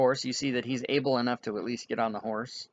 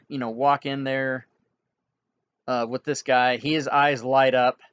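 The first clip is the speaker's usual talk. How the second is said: ordinary delivery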